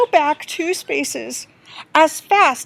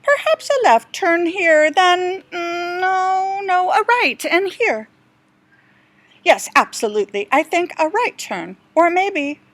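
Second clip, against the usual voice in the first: higher, fussy explorer musing over his map; a lost guide talking to himself, a little higher; dithering guide; 4 semitones higher than usual. Acted. The guide mutters over his map, lightly voiced and dithering.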